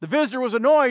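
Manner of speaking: usual speaking voice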